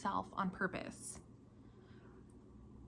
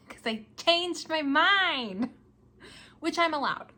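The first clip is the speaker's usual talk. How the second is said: laughing it out, higher and louder, slow; 8 semitones higher, 7 dB louder, slower than usual. Real, not acted. Vlogger admitting she changed her yarn plan, laughing. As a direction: laugh at your own change of heart.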